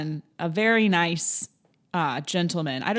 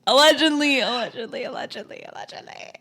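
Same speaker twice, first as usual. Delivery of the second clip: fading from loud to whisper; 7 semitones higher, 4 dB louder than usual. Pretend. Repeated word fading into whisper.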